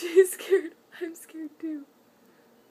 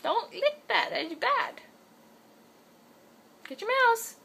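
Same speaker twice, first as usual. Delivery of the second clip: pet scolding; 9 semitones higher than usual. Real, not acted. Owner telling her cat not to lick, higher scolding. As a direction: scold the cat, sing-song.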